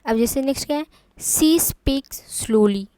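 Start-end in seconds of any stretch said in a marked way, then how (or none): 1.2-2.9 very slow, drawn-out words; slow demo reading; spoken slowly. Shown as a demonstration. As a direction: drag each word out slowly. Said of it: "slowly, यानी कि वो धीरे बोलती है".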